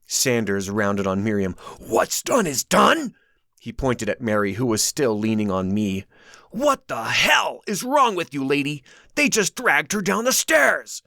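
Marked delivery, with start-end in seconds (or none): 1.8-3.1 high, slow, disbelieving; incredulous echo. A narrator's reading. Sanders echoing Miriam, incredulous. 6.5-11.0 angry outburst. A narrator's reading. Sanders angry at Miriam.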